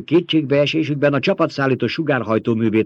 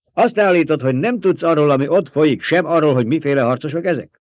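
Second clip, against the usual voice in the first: raised, louder interrogation; angry accusation; 6 semitones higher, 5 dB louder than usual. A narrator's reading. Emperor accuses baron of feigning ignorance, angry. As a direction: challenge sharply, pitch and volume up.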